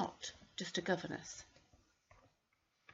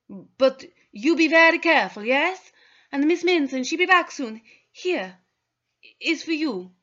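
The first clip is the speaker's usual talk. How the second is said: lightly voiced, a bit higher, worried maid; Tappy the servant; 7 semitones higher, 9 dB louder than usual. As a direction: speak simply, a little anxious. Tappy says goodbye to Maya.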